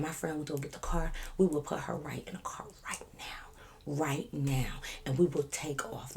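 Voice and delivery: soft, dropping to a whisper; hushed bargaining. Retold or imitated. Promising the officer to leave, hushed and whispery.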